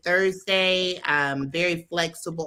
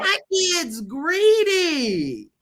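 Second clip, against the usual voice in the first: mock complaining mother; 12 semitones higher, 4 dB louder, much slower than usual. Joking about her greedy kids.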